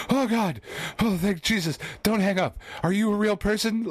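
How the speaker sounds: high-pitched, frantic relief; retold frantic phone freak-out. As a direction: plead as if the caller might vanish. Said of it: "Like, freaking out".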